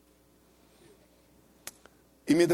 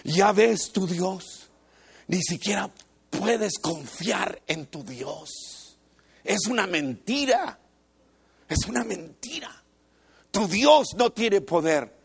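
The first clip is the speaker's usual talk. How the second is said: mocking devil. A hint of the devil mocking the drowning believer. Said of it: "cómo le gusta al diablo burlarse".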